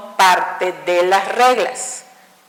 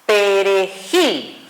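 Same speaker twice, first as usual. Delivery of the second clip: Perejil drawn out slowly, "gil" hit hardest; slow down and lean on the final "gil"; stress on gil; 2 semitones lower, much slower than usual. Shown as a demonstration. Teacher modelling the stress of perejil for the class. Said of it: "Suena más fuerte en gil".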